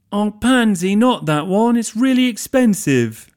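very high, loud, fussy plea; fretting host. Acted. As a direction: fret over the expensive book.

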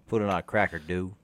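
put-on Cajun accent. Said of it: "you practice that, don't you?".

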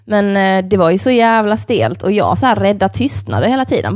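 ordinary talk, nothing marked

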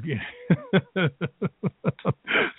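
laughing despite trying not to; suppressed laugh. Real, not acted. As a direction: burst of stifled giggling. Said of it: "I'm trying not to laugh about this".

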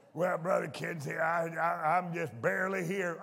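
mumbled, soft, half-awake reply; mock sleepy churchgoer. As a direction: play the tired member barely there. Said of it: "What's wrong with you?".

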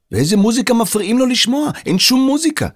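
high, incredulous questioning; bewildered protest; baffled. A narrator's reading. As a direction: question it like a puzzled kid. Puzzled boy questions the claim.

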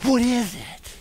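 put-on excited kid. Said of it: "They're gonna go".

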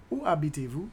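usual speaking voice

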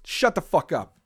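ordinary talk

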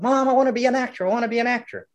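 lightly voiced kid begging mom, quick; child pestering his mom to be an actor. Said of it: "I said".

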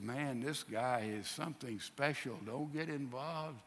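hushed warning. Retold or imitated. Pilate's wife warns him off this man, hushed imitation. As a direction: voice a wife's quiet warning.

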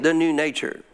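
ordinary talk, nothing marked

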